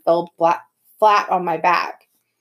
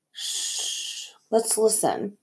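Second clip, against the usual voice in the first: quiet, cautious hush; 3 semitones higher, 6 dB quieter, much slower than usual. Acted. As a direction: hush the group to listen. Hushing friends to listen for pursuers.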